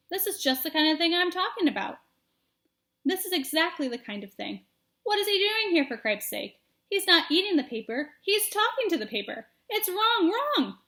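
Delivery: exasperated big brother. Acted. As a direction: complain like a fed-up sibling. Furlow grumbling about brother.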